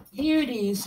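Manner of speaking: high, delighted "Here it is"; excited reveal. Real, not acted. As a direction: show it off with glee. Showing the gift, excited.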